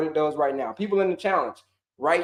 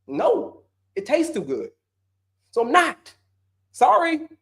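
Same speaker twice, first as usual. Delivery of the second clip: higher, bratty refusal, speeding up at the end; stubborn eater; 4 semitones higher than usual. Pretend. Coach playing the viewer telling the body it must suffer, bratty. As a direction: whine like a kid who won't give up sweets. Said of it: "But you're like".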